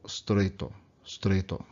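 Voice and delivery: "streito" with the su vowel swallowed into str; squeezed together. Shown as a demonstration. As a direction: push "streito" together fast. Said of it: "make it sound like streito".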